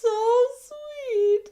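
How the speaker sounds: loud, very high-pitched wail; hammed-up sobbing over a tiny kindness, ending in a gasp; mock crying. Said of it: "I would be crying at the slightest thing".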